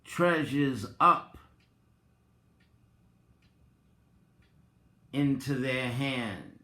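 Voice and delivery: measured, solemn reading over music; meditation reading. Acted. Vlogger reads a meditation passage to viewers, measured and solemn. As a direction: read it like a daily devotional to listeners.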